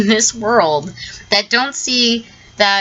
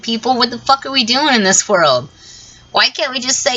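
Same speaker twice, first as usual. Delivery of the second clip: ordinary talk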